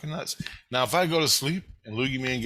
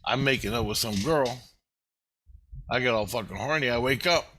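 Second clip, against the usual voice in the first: usual speaking voice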